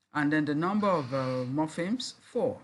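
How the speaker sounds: ordinary talk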